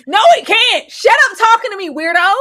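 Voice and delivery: loud, high angry snap; furious high snap at a pest; snapping back. Retold or imitated. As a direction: bite his head off, shrill with disgust. Voicing Trish snapping at him.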